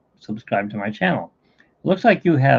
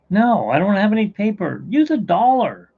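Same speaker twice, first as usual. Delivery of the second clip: exasperated retort; 9 semitones higher, 4 dB louder than usual. Acted. Wop answers in joke.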